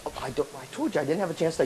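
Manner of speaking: low, soft and hurried; sheepish quiet self-defense; defensive excuse. Acted. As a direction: mumble a quick excuse under your breath. Excuse to nagging inner voice.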